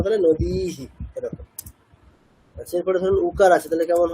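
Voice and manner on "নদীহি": slow "নদীহি", long "দী" and short "হি"; short final hi. Shown as a demonstration. Teacher summing up the rule, "নদীহি" ending in short হি. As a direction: draw out "দী", clip only the "হি". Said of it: "দীর্ঘ লিখলেও উচ্চারণটা হ্রস্বই করবো".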